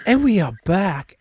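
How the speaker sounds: hyped comeback. Real, not acted. Podcast host reopening after break.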